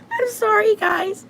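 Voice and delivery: high, squeaky words through laughter; helpless laughing. Real, not acted. Apologizing to viewers for breathing into the phone, helpless laughing. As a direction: crack up while trying to apologize.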